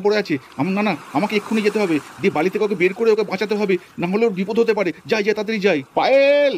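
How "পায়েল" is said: calling out. Acted. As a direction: call her name as you run to save her. Husband running to rescue wife. (উঁচু গলায় ছুটে আসার ডাক)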